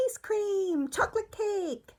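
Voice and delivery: high-pitched mock kid voice; child asking for treats. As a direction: squeak it up like an eager little kid. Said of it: "Opening up the door for them to say".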